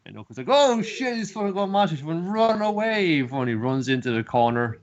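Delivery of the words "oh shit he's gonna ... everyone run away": high, loud alarmed cry; shrill-high panic, loud, telling the room to run; panicked warning. Acted. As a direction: yell at everyone to flee. Oaken fleeing the monstrous god.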